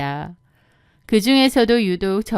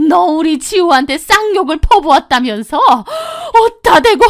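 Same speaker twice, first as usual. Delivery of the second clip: high, loud scolding mother-in-law; furious accusation; 7 semitones higher, 6 dB louder than usual. Acted. Mother-in-law scolding daughter-in-law for cursing, furious. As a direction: point and scold with outrage. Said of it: "삿대질을 했습니다".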